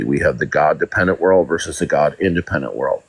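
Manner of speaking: usual speaking voice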